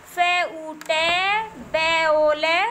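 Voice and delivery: slow letter-by-letter dictation with pauses; spelling out slowly. Shown as a demonstration. Teacher spelling a word for young children, slow dictation. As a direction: draw out each letter sound for learners.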